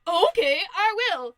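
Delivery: loud, high comic outburst; defiant wolf. Acted. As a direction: shout back, taking up the dare. Wolf takes up the pig's dare.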